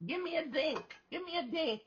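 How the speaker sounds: put-on high, squeaky child voice; imitating Hadley. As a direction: squeal it like a little kid with a burning mouth. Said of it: "She said".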